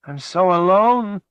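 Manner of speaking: wailing. Acted. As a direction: wail it out in despair. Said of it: "she wailed".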